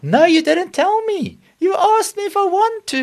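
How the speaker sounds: indignant protest. Acted. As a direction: squeal back in mock outrage. Acted quarrel over the rubbish.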